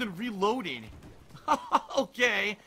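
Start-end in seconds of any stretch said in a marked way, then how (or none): 1.3-1.9 short high laugh of disbelief; exasperated laugh. Real, not acted. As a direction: laugh briefly at the absurdity. Laughs at the absurd death.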